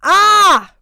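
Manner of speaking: loud delighted cry; delighted yell. Real, not acted. Reacting to the song playing with a loud shout. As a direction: let out a big yelp.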